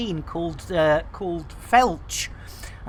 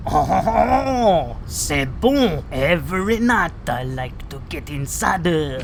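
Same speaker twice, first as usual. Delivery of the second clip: a bad hammed French accent; lightly put-on French accent; terrible French accent; 3 semitones lower, slower than usual. Streamer doing a bad French accent. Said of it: "terrible French accent there".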